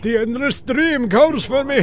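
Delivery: slow, solemn mock villain intonation; hammed-up echo of the villain's dying words. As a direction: parrot the villain's grand line, slowed down. Echoing the game villain's line.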